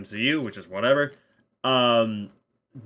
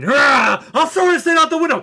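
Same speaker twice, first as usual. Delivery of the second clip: high, loud, fast imitated yell; mock shouted threat; 8 dB louder than usual. Fan reenacting the funny film moment, mock shouted threat. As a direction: burst into a hammed-up battle cry.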